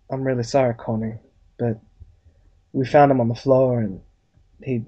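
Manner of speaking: low, halting doctor breaking bad news; Doctor Nolan. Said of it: "Doctor Nolan's there, and he's just like".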